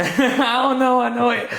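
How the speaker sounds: laughing high and loud. Real, not acted. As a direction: break into a real laugh on the words. Laughing at love question.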